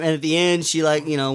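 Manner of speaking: ordinary delivery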